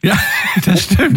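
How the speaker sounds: a real laugh breaking into the words; laughing agreement. Real, not acted. Host laughing as she agrees with the caller.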